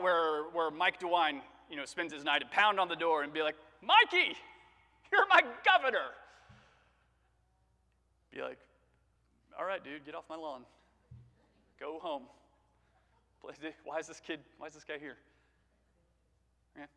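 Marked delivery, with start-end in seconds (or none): 3.8-6.1 high, loud holler for the governor; shouted, over-familiar greeting; mock door-pounding shout. 9.6-15.2 unimpressed governor. Acted. Voices unimpressed governor after shouting.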